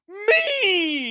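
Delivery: very high, loud hammed-up cry; mock-deranged squeal, stretched out; crazed witch. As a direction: shriek it like a cartoon lunatic. Batman Forever asylum ending, witch's answer.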